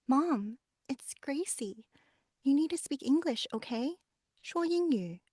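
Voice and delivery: high-pitched daughter urging her mom; insistent, high appeal to Mom; pleading daughter. Acted. Daughter urges mother to understand.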